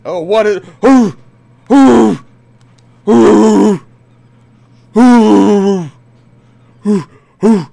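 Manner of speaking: high, very loud whooping; excited yelling. Real, not acted. Reacting to the game, screaming excitedly. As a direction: yell in wild excitement.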